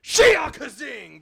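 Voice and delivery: drawn-out, high mock shout; demonstrated battle cry. Shown as a demonstration. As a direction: yell it out slowly as a silly attack cry. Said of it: "Something like this".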